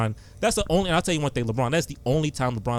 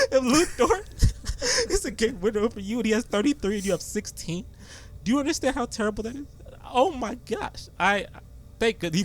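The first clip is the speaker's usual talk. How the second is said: mock crying; 9 semitones higher than usual. Mock anguish over lost game.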